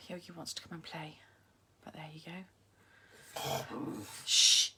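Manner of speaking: sighing, whispered under the breath; whispered aside. Real, not acted. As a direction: hush it so the viewers barely hear. Aside while the dog pesters.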